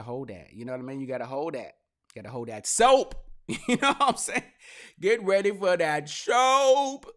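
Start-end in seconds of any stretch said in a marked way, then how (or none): 2.1-7.1 laughing jail joke. Real, not acted. Joking jail warning, laughing.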